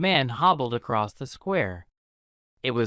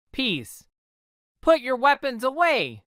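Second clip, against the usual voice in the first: higher mock shout to break up a fight; raised storybook shout; 11 semitones higher than usual. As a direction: call out to stop the brawl. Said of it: "Benvolio shouted".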